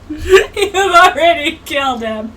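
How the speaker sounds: slow words broken by laughter; can't get the line out for laughing; laughing through it. Retold or imitated. Retelling the cartoon kid, laughing too hard.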